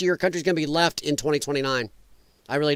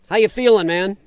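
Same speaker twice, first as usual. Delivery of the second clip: usual speaking voice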